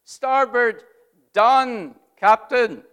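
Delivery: loud, high call-back; wheelsman's reply. Retold or imitated. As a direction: report the order done, firm and clear. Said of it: "he says".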